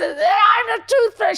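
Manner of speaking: high, loud wailing mother; crying mother shout. Retold or imitated. Mother caught as the tooth fairy, crying out loud and high. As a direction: howl it from the floor. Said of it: "she's like".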